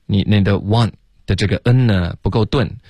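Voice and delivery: ordinary talk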